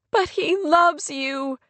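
high, slow, pained protest; a touch of tears, drawn out and high; tearful. A narrator's reading. Kate tells Rory of Clay's love, tearfully. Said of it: "The tears in Kate's voice".

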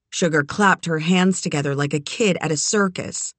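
ordinary delivery, nothing marked

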